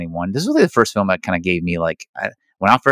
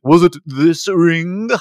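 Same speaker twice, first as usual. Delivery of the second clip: high, slow, louder dramatic reveal; hammed-up dramatic question; mock dramatic; 10 semitones higher, 5 dB louder, much slower than usual. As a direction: draw it out like a big reveal. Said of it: "And he's like".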